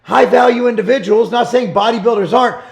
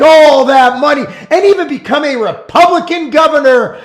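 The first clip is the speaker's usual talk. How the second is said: raised, loud angry tirade; indignant rant; 5 semitones higher, 4 dB louder than usual. Real, not acted. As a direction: pitch it high and hammer out the outrage. Indignant rant against Arnold.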